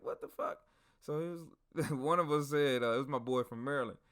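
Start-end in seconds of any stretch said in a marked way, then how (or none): 0.0-0.6 extremely high squeaked question; retold stunned reaction in falsetto; mock disbelief. Group reacts to the soldier's defiance, mock disbelieving.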